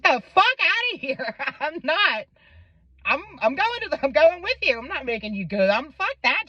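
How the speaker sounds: high-pitched imitation of a friend; retold incredulous, insistent refusal; indignant protest.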